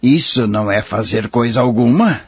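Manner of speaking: ordinary talk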